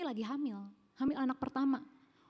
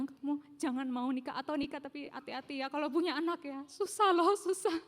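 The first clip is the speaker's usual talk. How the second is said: mildly voiced earnest warning, higher on susah; warning younger self; 4 semitones higher than usual. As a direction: caution your past self with a knowing nudge. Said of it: "saya bilang".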